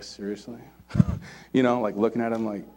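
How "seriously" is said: low, quiet, imagined incredulous aside; hushed, dry mock disbelief; deadpan disbelief. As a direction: act out an unimpressed reply, softly. Jesus imagined unimpressed by accusers.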